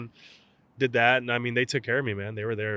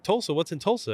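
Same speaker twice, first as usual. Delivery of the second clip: incredulous coach; 9 semitones higher, 3 dB louder than usual. Retold or imitated. Coach puzzled on the phone.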